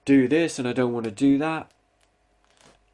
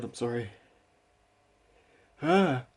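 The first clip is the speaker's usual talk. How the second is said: ordinary delivery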